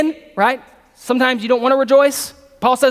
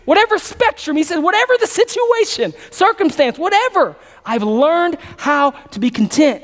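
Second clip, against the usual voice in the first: preaching at full force; 6 semitones higher than usual. Acted. Preacher pressing the verse on his congregation at full force. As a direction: drive the verse home to the room.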